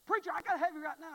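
loud, high urgent demand; frantic caller. Retold or imitated. Desperate man on the phone to preacher, loud. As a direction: beg the preacher now.

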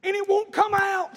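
put-on high, slow child's whine; wailing little boy. Preacher as son with stuck finger, mock wailing child. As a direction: draw it out like a tearful kid. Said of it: "He said".

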